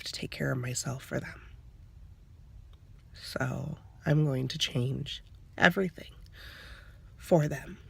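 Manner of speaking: a mother fighting not to cry while talking to her camera, choked and soft; holding back tears. Real, not acted. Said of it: "I'm trying not to cry".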